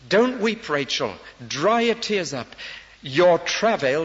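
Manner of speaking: loud, high, pleading call to a grieving mother; God's loud comfort. Acted. God calls out to weeping Rachel, loud and high. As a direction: cry out to her not to weep, big and urgent. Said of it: "and God says".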